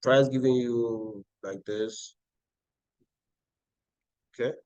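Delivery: ordinary delivery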